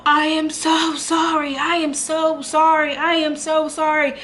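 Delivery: high, slow, overdone sorry; pleading apology. Retold or imitated. Mocking the boyfriend begging forgiveness, high and pleading. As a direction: ham up a desperate apology. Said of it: "he's like".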